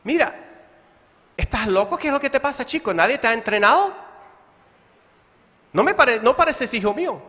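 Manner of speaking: mock exasperated father; an irritated dad's private rant at his boy; angry inner thoughts. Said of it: "lo que estoy pensando en mi mente es".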